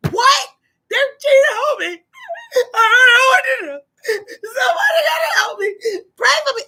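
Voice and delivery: hammed-up high-pitched wailing; mock boo-hooing. Acting out finding out a partner is cheating, boo-hooing. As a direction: wail it as if sobbing, overdone. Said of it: "baby, I'm boo-hooing".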